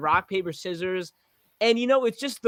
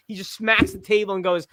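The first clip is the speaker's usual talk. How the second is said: ordinary delivery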